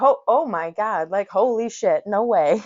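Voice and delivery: sudden alarm at the sleepy driver. Retold or imitated.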